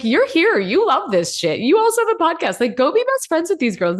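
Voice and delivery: high-pitched, insistent urging; emphatic self-talk. Retold or imitated. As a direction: play up an exasperated pep talk. Scolding herself in acted inner voice.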